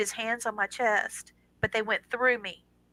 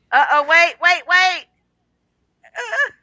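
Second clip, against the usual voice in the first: real surprise, higher and louder; startled; 8 semitones higher, 9 dB louder than usual. Real, not acted. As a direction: caught off guard as the door bursts open. Son bursting in during show.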